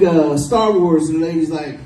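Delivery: ordinary talk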